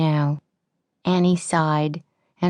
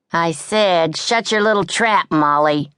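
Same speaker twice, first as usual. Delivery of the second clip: put-on louder, bossy tough-girl snarl; angry; 2 semitones higher, 4 dB louder than usual. A narrator's reading. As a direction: shut the little one up, hard. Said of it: "getting angrily out of bed".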